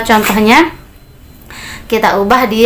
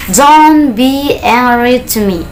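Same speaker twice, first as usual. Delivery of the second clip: slow, higher, drawn-out words; slow model sentence; 3 semitones higher, much slower than usual. Shown as a demonstration. Teacher models a prohibition for learners, slowly. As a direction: stretch each word out for learners.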